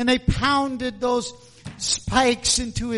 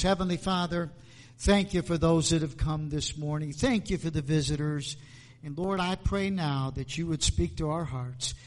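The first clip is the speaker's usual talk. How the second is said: hushed, earnest prayer voice; 5 semitones lower, 4 dB quieter than usual. Acted. Pastor praying over the morning congregation, hushed. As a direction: pray humbly to the Father.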